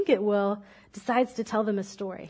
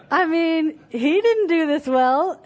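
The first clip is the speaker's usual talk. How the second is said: laughing; 5 semitones higher, 6 dB louder, slower than usual. Real, not acted. Laughing at the third servant.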